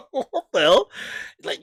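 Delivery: usual speaking voice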